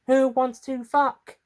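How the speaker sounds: slow, high, sultry invitation; seductive April. Acted. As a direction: tempt the room playfully. Posed sexually and seductively.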